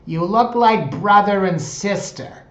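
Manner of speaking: high, loud, drawn-out mock grandma; imitated grandma. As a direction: imitate grandma exclaiming slowly at full volume. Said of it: "as her grandma said once".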